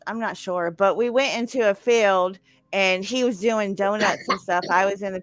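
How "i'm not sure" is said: ordinary talk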